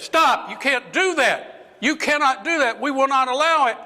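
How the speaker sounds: very high-pitched, mounting refusal; acted outcry of objection; vehement protest.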